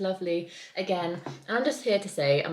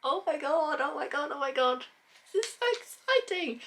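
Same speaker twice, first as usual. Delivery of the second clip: excited squeal; 9 semitones higher than usual. Real, not acted. Powering on Kindle.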